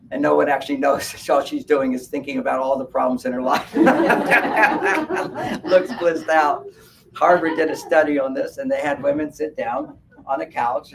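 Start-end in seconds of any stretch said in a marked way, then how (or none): 3.8-5.6 loud high burst of laughter. Real, not acted. Laughing at the blissed-out meditation ad, high laugh. 5.6-6.6 laughing. Real, not acted. Mocking the ad, slow.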